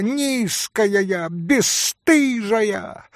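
high-pitched anguished lament; bitter self-reproach. A narrator's reading. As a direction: draw out the last words, high and anguished. Героиня горько клянёт себя. (горькое надрывное причитание)

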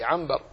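عنبر said as 'ambar; m hidden into b. Shown as a demonstration. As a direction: hide the meem of عنبر in the b. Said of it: "ثم أخفيت في الباء".

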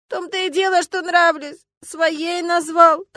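high, loud sobbing complaint; crying confession, pitched high; tearful wail. A narrator's reading. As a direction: whine it through tears, dragging the end. Heroine sobs out her confession.

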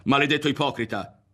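high, accusing tirade; narrated mother's angry scolding; angry cry. A narrator's reading. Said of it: "gridò allora".

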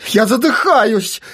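high, drawn-out cry of panic; trapped and panicking; panicked cry. Acted. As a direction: plead as if short of air, slow and high.

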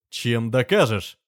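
loud, slow furious yell. Acted. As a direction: roar it, glaring. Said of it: "заорал он".

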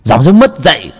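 very high, fast, strange shrieking curses; possessed boy cursing his parents. A narrator's reading. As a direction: spit abuse like a demon. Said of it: "vẫn còn đang chửi rủa".

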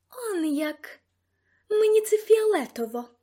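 madam's irate, high-pitched voice; angry outburst. A narrator's reading. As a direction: burst out in offended anger. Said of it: "гнівно сказала мадам Россіні".

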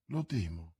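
low, soft, ominous reply; grave confirmation. Acted. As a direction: drop the voice and admit it. Milady gravely confirms the fear.

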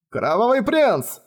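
high, slow rallying shout; battle cry. A narrator's reading. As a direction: cry out to the leader. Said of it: "С этим криком".

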